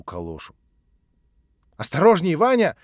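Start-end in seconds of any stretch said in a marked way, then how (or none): 1.8-2.8 loud, high-pitched warning call; calling after him. A narrator's reading. Natasha warning the narrator as he leaves, calling out. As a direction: call out to someone walking away. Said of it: "закричала она мне вслед".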